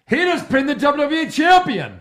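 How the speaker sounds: high-pitched imitation of an excited commentator; mock scream. Co-host mimics commentators overselling a pin, screaming it. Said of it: "why do they scream it".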